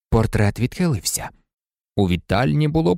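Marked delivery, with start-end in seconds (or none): none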